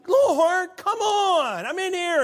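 high, loud, pleading call of Lord; exasperated shout to God. Shown as a demonstration. Preacher voicing frustrated Martha calling out to Lord. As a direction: yell it like she's fed up in the kitchen. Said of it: "No, they're like".

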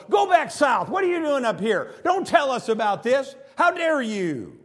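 furious temple priest shouting; angry priest. Retold or imitated. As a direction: drive the prophet away in outrage. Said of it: "The priest of Jeroboam's temple comes out and goes".

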